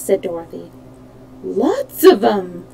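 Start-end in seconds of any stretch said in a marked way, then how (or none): 1.4-2.6 slow, drawn-out exclamation; exclaimed slowly in a put-on voice; crying out. A narrator's reading. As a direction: stretch it out in a child character's cry. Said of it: "cried Br-, uh, Button-Bright".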